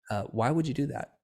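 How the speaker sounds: imitating a therapist's gentle question; very friendly. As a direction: ask kindly, without any accusation. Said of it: "In a very friendly tone".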